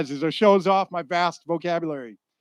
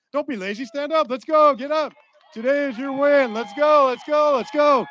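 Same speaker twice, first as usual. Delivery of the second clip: high, loud rallying calls; rousing shout; 7 semitones higher, 5 dB louder than usual. Real, not acted. Speaker urging graduates to stand up, shouting. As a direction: whip the crowd up like a coach.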